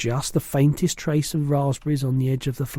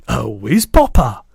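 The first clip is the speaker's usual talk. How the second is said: louder, delighted giant exclamation; beaming cry; 3 semitones lower, 7 dB louder than usual. A narrator's reading. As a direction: burst out with glee. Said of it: "cried the B F G, beaming".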